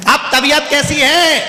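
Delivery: hammed-up loud question pitched high; loud asking. Half-deaf visitor asks the patient his health, mock loud. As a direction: ask like a deaf man shouting politely. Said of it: "चिल्ला के बोलता है".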